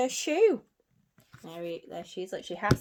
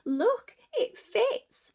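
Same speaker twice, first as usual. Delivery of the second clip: squeaky high, loud fib; hammed-up lying sister; fibbing stepsister; 6 dB louder, much slower than usual. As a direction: stepsister insisting the shoe fits. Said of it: "she's telling fibs".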